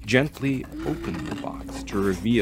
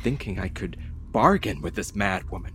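plain reading voice

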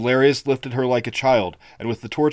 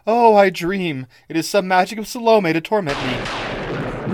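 higher, slow lines of a weeping queen; tearful queen; 7 semitones higher, 4 dB louder than usual. Acted. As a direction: speak as if you still dream. Queen voiced in tears.

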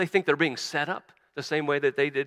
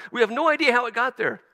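panicked babble; 9 semitones higher, 6 dB louder than usual. Acted. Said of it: "going a hundred miles an hour".

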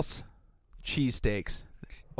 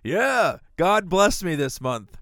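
mock pious boast; 6 semitones higher, 7 dB louder, slower than usual. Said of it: "You know what I mean".

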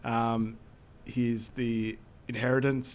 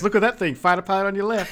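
mock excited; 7 semitones higher, 5 dB louder, faster than usual. Mimicking the same character.

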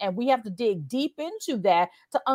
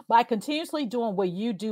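normal talking voice

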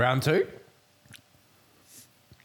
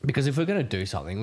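ordinary delivery

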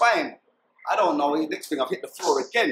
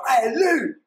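high, loud call to a friend; re-enacted alarmed holler; retold shout; 10 semitones higher, 5 dB louder than usual. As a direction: yell out a warning, pitched way up. Calling out to friend mid-shooting.

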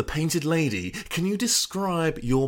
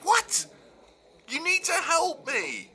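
desperate pleading; 9 semitones higher, 5 dB quieter than usual. Acted. Ben desperate on phone.

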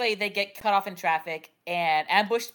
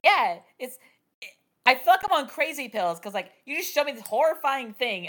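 ordinary talk, nothing marked

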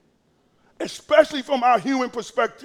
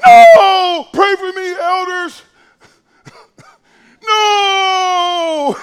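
high, loud, drawn-out no; Jesus refusing; 7 semitones higher, 9 dB louder, much slower than usual. Pretend. Preacher voicing Jesus, loud and high refusal. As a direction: call out a long, loud, lofty refusal. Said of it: "And Jesus going".

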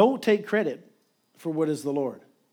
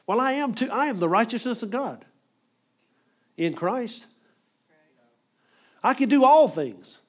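mock proud boast; 6 semitones higher, 4 dB louder than usual. Preacher mocking a self-made believer, loud boast. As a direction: pitch jumps higher into a loud boastful claim.

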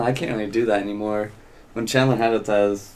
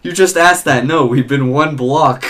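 loud, high mocking retort; mock exasperated friend; friend's exasperated reply; 5 semitones higher, 9 dB louder than usual. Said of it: "he's like".

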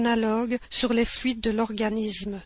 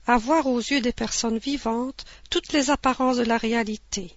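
normal talking voice

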